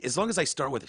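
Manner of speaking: ordinary talk, nothing marked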